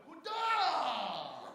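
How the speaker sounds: a short, sharp, hammed-up battle cry; mock battle cry. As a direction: hoot it like a silly attack yell. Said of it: "and I went".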